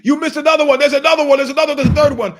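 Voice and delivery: loud, high yelling across the street; shouted tip-off. Retold or imitated. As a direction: yell it to the cops down the block. Guys shouting at police.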